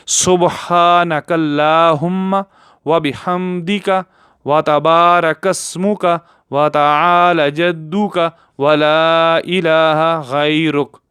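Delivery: slow, careful Arabic recitation; model recitation. Shown as a demonstration. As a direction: recite the sana slowly for learners. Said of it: "दोबारा एक दफ़ा मैं पढ़ लेता हूँ". (धीमी तिलावत, सिखाने के अंदाज़ में)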